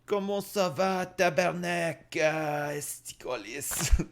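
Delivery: high, mock Québécois French greeting; a hammed-up Québécois French accent; mock French opener. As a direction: ham up a French phrase with slow curses. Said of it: "brush up on my French".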